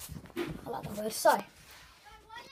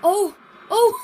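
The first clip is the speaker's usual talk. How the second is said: loud, higher cries of oh; excited shout; 5 semitones higher, 12 dB louder than usual. Real, not acted. Boy watching a last-minute tackle, excited loud shouts. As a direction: yell at a near miss on screen. Big near miss in game.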